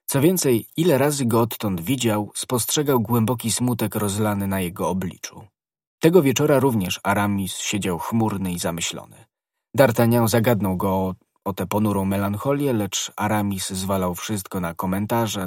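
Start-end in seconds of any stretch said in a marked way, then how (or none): none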